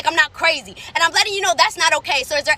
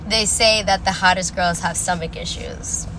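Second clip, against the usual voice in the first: low, slow put-on voice; slow, deep mock pronouncement; quoted claim; 8 semitones lower, slower than usual. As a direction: recite the saying slowly and low before mocking it. Quotes claim before rebutting it.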